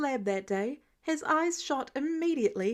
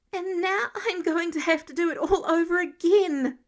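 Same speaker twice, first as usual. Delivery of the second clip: upset outburst; 5 semitones higher, 6 dB louder than usual. Acted. Sleep-deprived teen venting.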